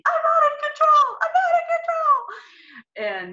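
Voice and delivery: very high, loud screaming, slowing down; screaming in labor. Retold or imitated. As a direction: scream it out mid-contraction. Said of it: "And I just start screaming".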